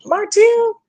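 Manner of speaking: high, disbelieving gasp of a name; shocked "Martell?". Retold or imitated.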